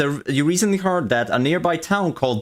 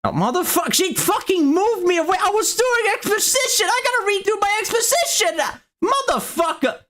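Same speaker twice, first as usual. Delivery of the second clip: high-pitched frustrated yelling; exasperated outburst. Real, not acted. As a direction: shout it in high, outraged protest. Game master angrily complains at being moved.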